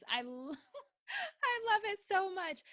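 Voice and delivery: laughing. Real, not acted. Gushing over the plaque.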